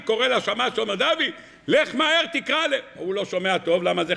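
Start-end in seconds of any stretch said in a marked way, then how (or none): 0.9-2.7 high, hurried call over the noise; urgent call. Acted. Host calling to the attendant over the music, urgent. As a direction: hurry the attendant along.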